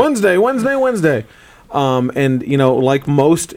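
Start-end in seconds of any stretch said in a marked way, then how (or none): 0.4-1.2 booming hyped-up announcer, much higher; monster truck ad. Pretend. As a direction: shout it like a monster truck rally promo. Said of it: "Monster Truck Rally".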